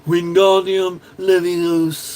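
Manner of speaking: slow, drawn-out spell in a put-on voice; Flash Gitz Harry. Mimicking the Flash Gitz cartoon Harry casting a spell, slow. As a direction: stretch every syllable like a cartoon wizard. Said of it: "when he's like".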